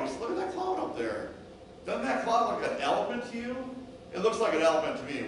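high, lively pointing out of silly sights; playful joking chatter. Acted. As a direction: distract a friend with light banter. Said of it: "and he jokes with you".

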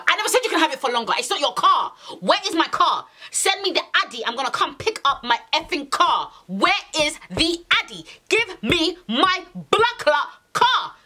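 angry demand. Retold or imitated. Girlfriend ordering boyfriend to return her car, angry and insistent. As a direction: act out losing it, ending word by word.

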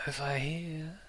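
very soft, breath-light whisper; faint whisper. Acted. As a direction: whisper it as if from far off. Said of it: "you hear the faint whisper".

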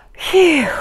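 long blown-out breath; breathy "phew". A narrator's reading. Mother and child calm themselves, deep exhale. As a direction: blow out a falling "phew". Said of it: "let out a deep breath".

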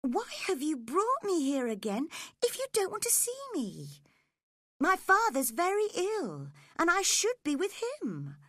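put-on high, indignant girl's voice; upset reproach. A narrator's reading. Cathy to Linton, her father ill, upset reproach. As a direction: pitch it high and urgent, pleading to be understood.

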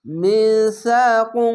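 drawn-out chanted recitation of মিসাকুম; slow tajweed chant; slow Quran recitation. Shown as a demonstration. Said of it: "এখানে ইকফাকু না".

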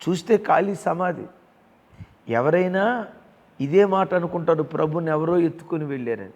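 loud, high sermon climax; fiery preaching. Real, not acted. Preacher assuring a troubled listener, loud and high. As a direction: drive the message home at full force.

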